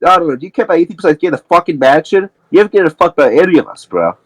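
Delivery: fast, high-pitched accusation; heated retort. Acted. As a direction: throw it back at him in a rush. Acted retort in a heated quarrel.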